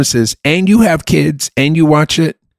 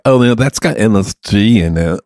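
a lightly voiced fussy millennial comic; mock millennial comedian; 4 semitones lower than usual. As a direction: parody a finicky millennial fretting about MSG. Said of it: "is that the accent of the millennial comic".

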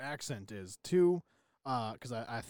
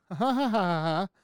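loud, higher canned ha ha ha; a machine laughing on command, hammed up; mock assistant laugh; 4 semitones higher, 7 dB louder than usual. Imitating the Echo doubling down with a mock canned laugh. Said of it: "your Echo then doubles down, goes".

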